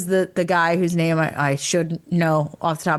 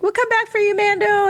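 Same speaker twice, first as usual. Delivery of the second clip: much higher, tiny cheerful creature voice; high-pitched mock alien promise; little creature voice. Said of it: "they're little like".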